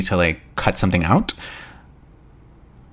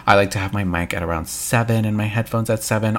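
usual speaking voice

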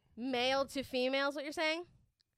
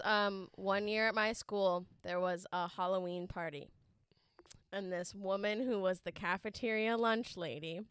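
ordinary talk, nothing marked

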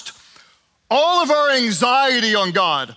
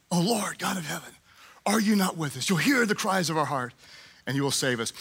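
hushed prayer; 5 semitones lower, 6 dB quieter than usual. Acted. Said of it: "this is what Jehoshaphat did".

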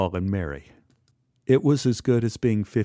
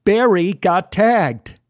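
ordinary delivery

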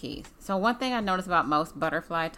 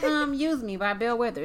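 usual speaking voice

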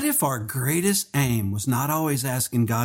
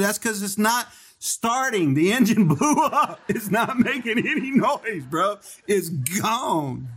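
laughing through the punchline; 6 semitones higher than usual. Retold or imitated. Telling the mechanic friend the engine blew, laughing. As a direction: chuckle while telling the joke.

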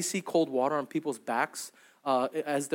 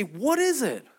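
puzzled; 8 semitones higher, slower than usual. Retold or imitated. Israelites puzzled by manna.

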